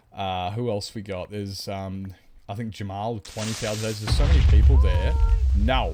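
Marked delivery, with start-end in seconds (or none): 4.0-5.9 much louder all at once; a loud burst at chat; sudden loud outburst. Real, not acted. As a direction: blurt it out loud.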